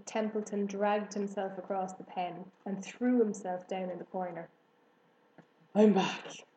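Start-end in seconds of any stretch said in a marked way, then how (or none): 5.7-6.4 a rat's husky, worn-out voice. A narrator's reading. Rat back from a night's gorge, husky. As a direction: sound rough after a night of feasting. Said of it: "he said in a husky voice".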